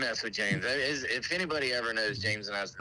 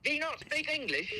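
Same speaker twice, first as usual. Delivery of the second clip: very high mock-British indignation; high fake British prank caller; British accent; 14 semitones higher than usual. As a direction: challenge them in a put-on British accent. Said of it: "the best British accent I've ever heard".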